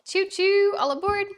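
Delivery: high, loud choo choo, all aboard; train conductor call. Acted.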